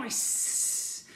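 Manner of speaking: breathy cat hiss. Acted. Cats hissing in story.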